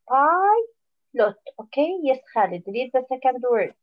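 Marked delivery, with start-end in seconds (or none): 0.1-1.4 Pilot with a drawn-out, higher first syllable; long vowel in Pilot. Shown as a demonstration. Teacher showing the class the open syllable, long i in Pilot. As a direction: stretch the long i in Pilot, then add lot. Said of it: "it has a long vowel sound".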